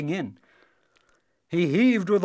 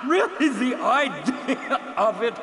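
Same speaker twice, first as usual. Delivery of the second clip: laughing, gasping; 8 semitones higher, 4 dB louder than usual. Acted. Dragon answers the boy through gasping laughter. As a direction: barely get it out through laughing. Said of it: "almost sobbing, gasping for breath".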